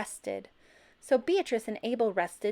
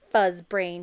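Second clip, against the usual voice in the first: mutter; 2 semitones lower, 7 dB louder than usual. A narrator's reading. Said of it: "she muttered".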